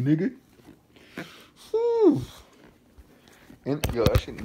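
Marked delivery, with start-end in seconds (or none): 1.7-2.4 very high, loud admiring oof; impressed oof. Real, not acted. Vlogger gasping at the sneakers, a high loud oof. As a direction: let out an awed oof.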